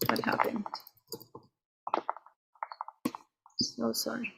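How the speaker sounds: muttering softly to herself, confused; under her breath, what went wrong; puzzled muttering. Real, not acted. Slides broken, muttering to self.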